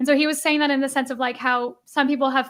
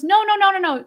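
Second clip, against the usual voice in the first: higher, louder string of no's; urgent objection; 6 semitones higher, 5 dB louder than usual. Retold or imitated. Neighbor stopping a man with a chainsaw, urgent objection. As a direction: cut in to stop someone. Neighbor stops him chopping tree.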